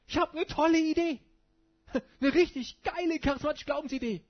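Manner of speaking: high, loud, giddy mock enthusiasm with a laugh; mocking Jesus. Preacher mocking a reckless Jesus idea, giddy and loud. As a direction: ham up a silly bright idea. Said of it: "dass Jesus denkt".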